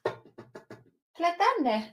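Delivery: slow, drawn-out call to a pet in Finnish; calling the dog. Real, not acted. Calling Betty the dog over in Finnish, slow coaxing call.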